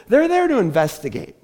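ordinary talk, nothing marked

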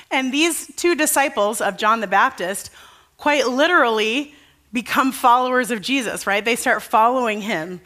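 usual speaking voice

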